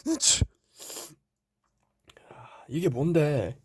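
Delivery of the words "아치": high sharp sneeze; comic follow-up sneeze. Acted. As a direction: fire off another sneeze. Second staged sneeze.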